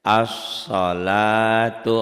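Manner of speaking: As-salatu chanted very slowly and loudly, lam silent; recited Arabic example. Shown as a demonstration. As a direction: draw out As-salatu as in tilawah. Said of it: "alif lamnya nggak dibaca ya". (As-salatu dilantunkan panjang, lam tidak dibaca)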